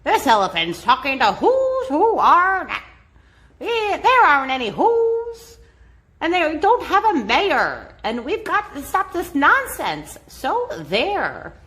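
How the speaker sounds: taunting brothers, lightly hammed; jeering monkey gang; mock shouting monkeys. A narrator's reading. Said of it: "came shouting".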